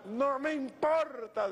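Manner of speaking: high, drawn-out mimicry; high-pitched retold exclamation; imitated supporter's reply. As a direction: imitate an undaunted woman, stretched and high. Acts the woman's reply again.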